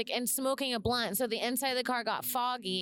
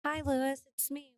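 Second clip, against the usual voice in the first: put-on young girly voice; 2 semitones higher than usual. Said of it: "should I do my woman voice".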